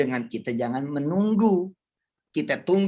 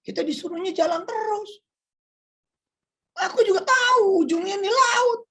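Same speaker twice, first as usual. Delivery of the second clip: rising, higher and louder exasperated voice; Moses answering; 4 dB louder than usual. Retold or imitated. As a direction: make Moses sound pushed to the edge. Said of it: "kata Nabi Musa".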